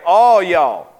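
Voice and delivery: "All y'all" said with a stress on "All"; a playful North Carolina plural; Southern "all y'all". Shown as a demonstration. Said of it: "you would say".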